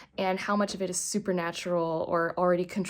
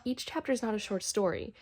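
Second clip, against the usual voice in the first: usual speaking voice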